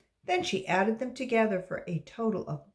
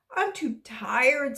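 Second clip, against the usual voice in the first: tired; 6 semitones higher, 5 dB louder, slower than usual. Acted. Tired child.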